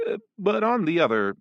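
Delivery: ordinary delivery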